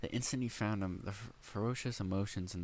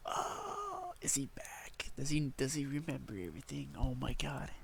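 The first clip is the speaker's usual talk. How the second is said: much higher, gasping "is he back?"; excited gasp; 5 semitones higher than usual. Real, not acted. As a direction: hit the realization with a high excited squeak. Reader guesses Subaru remembers.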